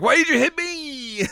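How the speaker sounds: high-pitched whine; a high-pitched whining complaint; whiny complaint. Retold or imitated. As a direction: complain about getting hit. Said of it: "She's like".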